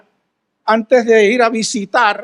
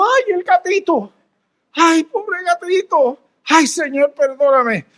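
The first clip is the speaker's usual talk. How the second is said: worried cry; 6 semitones higher than usual. Retold or imitated. Pastor sees cat fly off.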